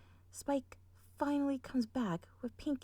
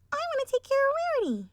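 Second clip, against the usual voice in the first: high, fast, loud little filly; yelling filly; 8 dB louder, faster than usual. Acted. Sweetie Belle eagerly claiming Rarity, high and loud. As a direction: shout it eagerly like a small child. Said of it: "yells Sweetie Belle".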